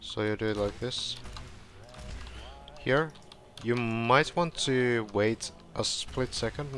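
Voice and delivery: usual speaking voice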